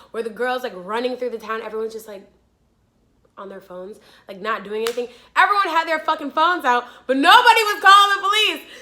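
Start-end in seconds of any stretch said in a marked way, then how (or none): 5.3-8.7 loud, high exasperated complaint; indignant outburst. Real, not acted. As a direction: rail at the useless bystanders. Indignant about bystanders doing nothing.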